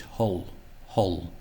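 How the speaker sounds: "Hull" with a deep, dark final L. Shown as a demonstration. Teacher showing English L, dark-ended "Hull". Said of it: "to lower that L".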